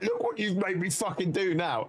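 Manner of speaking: imitating a furious kid, not full force; retold angry outburst. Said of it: "turned to his mum all angry".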